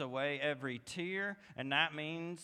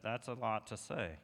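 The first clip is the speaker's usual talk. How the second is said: ordinary delivery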